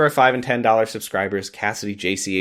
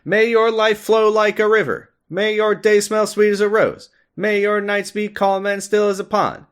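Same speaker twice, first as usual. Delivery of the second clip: high, ceremonious prayer voice; solemn prayer; 10 semitones higher than usual. Acted. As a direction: intone it like a blessing. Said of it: "please say the Doctor's Prayer".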